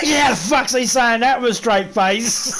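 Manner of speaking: real laughter breaking through a slow, loud line; can barely get it out for laughing. Real, not acted. Laughing at the man saying it with a straight face.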